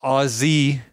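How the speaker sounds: "Aussie" tried carefully as OZ-ee; careful attempt at "Aussie" with a Z; trying Aussie with a Z. Shown as a demonstration. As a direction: learner copying "Aussie" with a Z. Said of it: "Is that right?".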